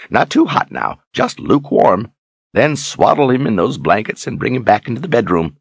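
put-on high, quick old mentor's orders; old wizard instructing. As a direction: rattle off what to do. Zed giving instructions.

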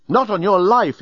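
a touch of affronted protest; indignant exclamation. A narrator's reading. Nettie refuses the journalist's order, indignant. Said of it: "exclaimed Nettie".

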